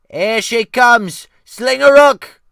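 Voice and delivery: high, loud, slow alarm shout; cook's cry. Acted. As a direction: yell the warning as the wave breaks. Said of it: "the cook cried".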